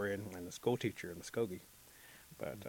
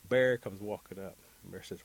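ordinary delivery

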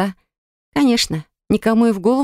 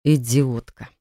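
a hint of annoyed rector; muttered thought; 6 semitones lower, slower than usual. A narrator's reading. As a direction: lower voice, exasperated. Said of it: "Так подумал Даррен Нерс".

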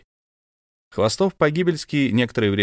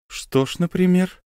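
surprised question; 9 semitones higher than usual. Acted. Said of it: "удивлённо спросил".

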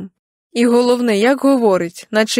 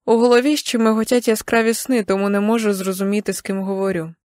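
plain reading voice

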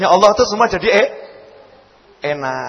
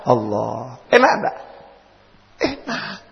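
usual speaking voice

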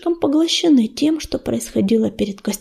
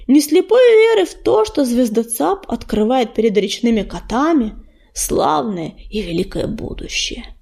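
ordinary delivery

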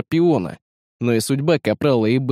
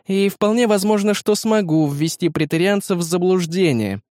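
higher, brisk hologram assistant; higher AI girl voice; 6 semitones higher than usual. A narrator's reading. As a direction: report it like a crisp AI. Beta answers the rebels.

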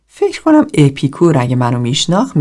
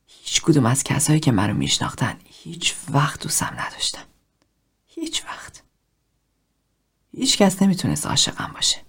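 tearful whisper; 7 dB quieter than usual. A narrator's reading. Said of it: "چشمانش پر از اشک شده بود".

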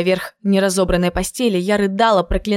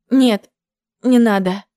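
plain reading voice

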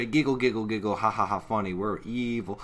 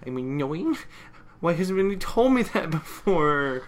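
hurt squeak; 8 semitones higher, 4 dB louder than usual. Retold or imitated. Hurt pony voiced in retelling.

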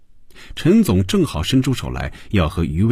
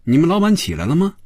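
sarcastic, high-pitched questions; taunting, pitch climbing at the end; mocking; 7 semitones higher than usual. A narrator's reading. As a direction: needle them with a mock-innocent question. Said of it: "嘲讽地说".

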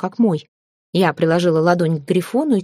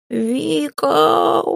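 high, drawn-out yowl; roaring cat; 9 semitones higher than usual. A narrator's reading. Cat howls for his mistress to back him up, high yowl. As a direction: howl the name high like an outraged cat. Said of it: "взревел Филя".

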